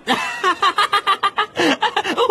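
big high laugh; hearty laugh. Acted. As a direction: burst out laughing. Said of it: "弗雷德哈哈大笑". (哈哈大笑)

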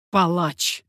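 loud cry, pitch held down; shouted accusation. A narrator's reading. Father accusing his son's killer, shouting. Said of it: "крикнул Меркандон".

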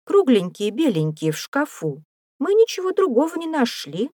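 put-on high-pitched child explaining herself; eager girl's voice, climbing to a rushed excuse; little girl. A narrator's reading. Girl explains what children found.

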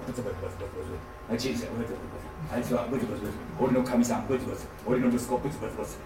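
low, soft mumbled complaining under the breath; mock grumbling. Preacher mimicking churchgoers who grumble about life, soft low mumbling. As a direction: imitate a sulky grumbler muttering on and on.

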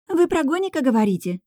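high-pitched gushing excitement; starry-eyed eager question; delighted. A narrator's reading. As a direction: burst in thrilled, pitch way up. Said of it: "восхитилась Алика".

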